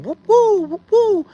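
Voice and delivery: high hooting bird call, whoop woo; imitated bird call. Camper imitating the strange birds heard in the woods, high hooting call. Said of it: "we kept hearing them".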